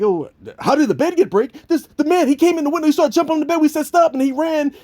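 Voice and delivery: put-on high, eager kid's voice; young Bill's excuse. Little Bill fibbing to his father, high kid voice. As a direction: play the lying little boy, high. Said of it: "this scene is like".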